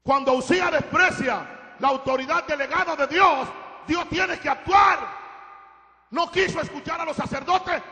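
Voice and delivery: high, loud, drawn-out pulpit shout; full-force preaching. Real, not acted. Preacher warns the church we are nothing without God, full-force preaching. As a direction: build to a roaring, stretched-out declaration.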